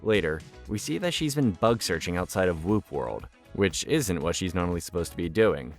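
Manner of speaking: ordinary talk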